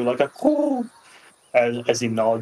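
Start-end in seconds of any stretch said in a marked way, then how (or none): 0.0-0.8 bird coo. Acted. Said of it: "And it lets out, like, a coo".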